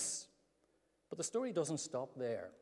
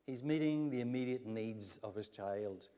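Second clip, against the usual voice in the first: ordinary talk, nothing marked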